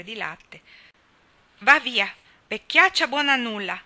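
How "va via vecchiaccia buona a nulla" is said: much louder and higher, scolding the cow; angry shout. A narrator's reading. Nan, frustrated by the dry cow, shouts her away. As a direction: yell it at the useless cow, giving up. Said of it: "gridò l'ingrata Nan".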